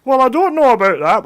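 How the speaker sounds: mock doubt at a clueless dick; his sceptical voice. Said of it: "I sometimes go".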